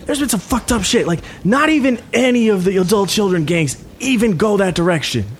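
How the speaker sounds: put-on high-pitched young tough; high, put-on character voice; wary gang leader. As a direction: warn about a place even gangs avoid. Game master plays gang leader.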